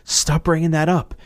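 a hint of an exasperated wife; half-whispered then sharper plea to drop it; lightly voiced fed-up wife; annoyed wife. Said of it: "She's like".

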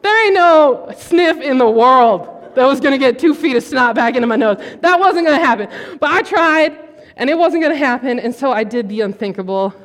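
usual speaking voice

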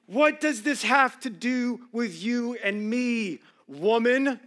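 preacher acting Jesus's sharp reply to his mother; voicing Jesus. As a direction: let Jesus push back slowly. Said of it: "Listen to what he says".

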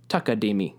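takadimi quick within one beat; fast takadimi. Shown as a demonstration. Teacher showing takadimi at real speed. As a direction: zip through takadimi. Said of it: "they go pretty fast".

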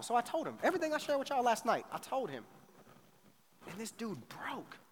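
ordinary delivery

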